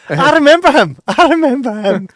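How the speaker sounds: laughing through the words; amused recognition, high and loud. Real, not acted. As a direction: giggly exclaim.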